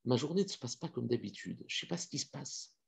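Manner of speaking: soft, lost muttering; bewildered murmur. Acted. Troubled man talking to himself, soft and bewildered. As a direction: say it under your breath, dazed. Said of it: "il est complètement perturbé".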